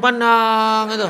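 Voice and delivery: slow, loud drawn-out stare line; storyteller's slow loud punchline; slow dramatic beat. Acted. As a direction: drag it out for the punchline.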